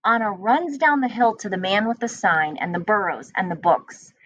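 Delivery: ordinary talk, nothing marked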